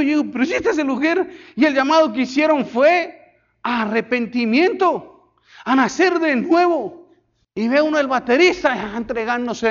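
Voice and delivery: high, loud, fervent preaching; preaching at full force. Acted. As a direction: preach it out to the whole congregation.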